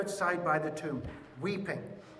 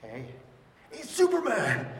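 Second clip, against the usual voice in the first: higher, louder laughing hero voice; hammed-up Superman reveal to Lois; mock superhero reveal; 4 semitones higher, 5 dB louder than usual. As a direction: announce it like a caped hero. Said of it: "He went".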